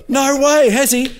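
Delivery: high, loud fake astonishment; Bradley faking shock to the bosses, very high; mock disbelief. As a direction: ham up the surprise.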